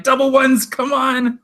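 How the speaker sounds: high-pitched mock plea to the dice; mock rooting for dice. Acting out player hoping for roll.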